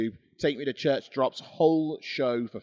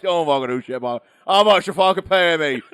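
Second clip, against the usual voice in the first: Mick Foley; 4 semitones higher, 9 dB louder than usual. Pretend. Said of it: "What's the worst you're gonna say?".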